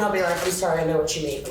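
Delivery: ordinary delivery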